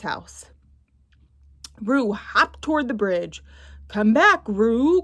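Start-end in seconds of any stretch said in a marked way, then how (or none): 3.9-5.0 a louder call after him. Acted. Tigger calling Roo back from the bridge, loud. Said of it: "called Tigger".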